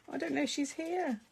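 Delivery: ordinary delivery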